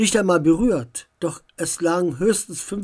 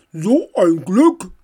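slow, loud goblin voice; fat goblin; 7 dB louder, slower than usual. A narrator's reading. Fat goblin turning up behind the group, slow creature voice. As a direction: play a big slow goblin, loudly. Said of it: "sagte der dicke Kobold".

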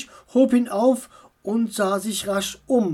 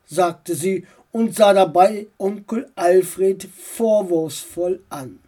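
ordinary delivery, nothing marked

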